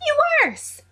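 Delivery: high, yelped mock insult; mock outrage. James squawks a mock insult at Sirius over the list. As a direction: squawk it in joking offence.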